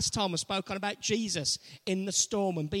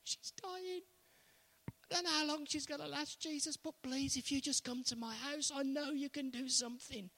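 higher, softer desperate plea; a kneeling father begs Jesus in a hushed, higher plea; begging; 4 semitones higher, 7 dB quieter than usual. Acted. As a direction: beg quietly, as a father kneeling. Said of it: "and he's begging Jesus".

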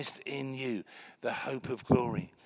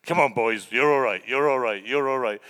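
loud sergeant rallying his troops; old sergeant; 9 dB louder than usual. Retold or imitated. Old sergeant to frightened soldiers in a dream, rallying shout. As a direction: call out over the ranks, loud and punchy. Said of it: "the old sergeant with scars all over me going".

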